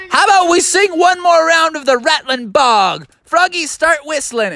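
high-pitched, excited call; rally everyone for a song; rallying teacher. Acted.

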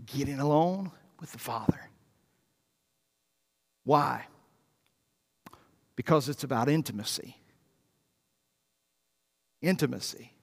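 low, soft and confiding; hushed intimacy. Real, not acted. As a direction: drop to a near-whisper for a private moment. Preacher hushes to speak of intimacy.

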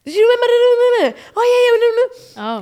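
high-pitched mock movie chatter; garbled high gabble in mockery; chattering relatives. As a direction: imitate relatives babbling over the film, high and loud. Mimics family chattering during films.

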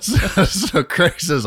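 laughing through the words; can't stop laughing while telling it; giggling mid-story. Real, not acted.

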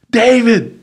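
calling out across patio. Retold or imitated. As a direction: hail a friend from across the bar.

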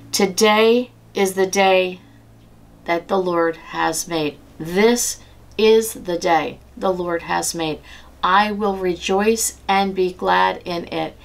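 slowly, each word weighed; slow reverent recitation. Shown as a demonstration. Recalling her mother-in-law's faith, slow reverent recitation. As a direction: recite the verse slowly, like a prayer.

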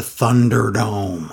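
Thunderdome drawn out with weight; emphasis on Thunderdome. Real, not acted. Host announcing where Ric Flair showed up, emphasis on Thunderdome. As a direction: lean hard on the word Thunderdome. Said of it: "I don't know why I emphasize Thunderdome".

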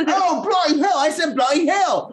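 very high, strained Aussie yelling; hammed-up exasperated Australian; shouty Australian accent. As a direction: wail it in broad, cartoonish Australian. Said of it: "I do my Australian voice".

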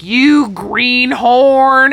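hammed-up ranting; bizarre rant. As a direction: stretch the insult long, loud and high. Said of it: "he's so bizarre in his little rant".

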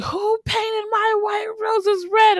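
very high, slow, drawn-out shout; Queen of Hearts shout. Retold or imitated. The queen demanding who painted her roses, a slow high shout. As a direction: bellow it out like an outraged queen.

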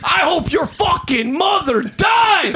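high, drawn-out mock scream; mock road rage. Driver cursing another car, retold as hammed-up rage. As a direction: curse the other driver in hammed-up fury.

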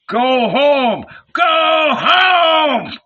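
high, drawn-out crowd yell; loud, high heckle; jeering shout. A narrator's reading. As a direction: shout it like a mocking mob. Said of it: "The burghers also shouted, laughing".

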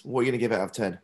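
ordinary talk

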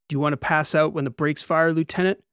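pushing a trainee hard; drill instructor's challenge. A narrator's reading. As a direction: goad the lieutenant with a rising question. Instructor pushes trainee during drill.

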